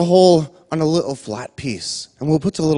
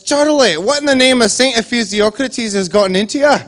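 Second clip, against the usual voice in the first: much higher, louder Scottish wife voice woken in the night; a jolted wife scolding her husband awake; startled wife; 7 semitones higher, 4 dB louder than usual. Retold or imitated. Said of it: "and she wakes up and says".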